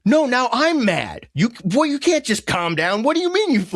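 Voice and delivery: high-pitched acted indignation; mad. Helper who just got mad at the upset friend, high and indignant. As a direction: flare up in exaggerated exasperation. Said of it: "who just got mad".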